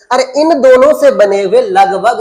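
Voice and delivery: ordinary delivery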